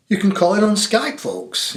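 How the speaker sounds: loud announcement. Real, not acted.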